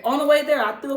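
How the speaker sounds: excited. Real, not acted. Telling friends about throwing up, excited. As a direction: jump in with energy.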